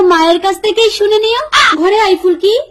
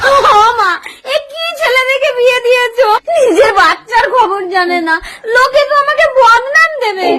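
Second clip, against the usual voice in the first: high-pitched tearful lament; hammed-up crying protest; wailing complaint; 4 semitones higher than usual. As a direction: wail to your mother about your husband. Daughter wails complaint to mother.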